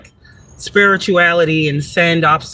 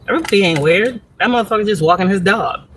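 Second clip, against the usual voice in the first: usual speaking voice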